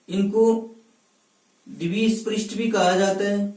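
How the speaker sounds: usual speaking voice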